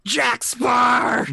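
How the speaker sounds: high, loud dashing cry; battle shout. Acted. Player acting out the character's dash, battle shout. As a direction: shout it mid-charge.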